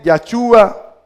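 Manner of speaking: Yachúa with an "a" in the first syllable; Yachúa, opening on "ya", stressed on "chú"; name variant. Shown as a demonstration. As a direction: say Yachúa as "ya-CHU-a". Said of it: "Se lo explico".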